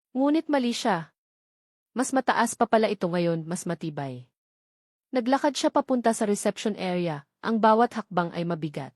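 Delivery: plain reading voice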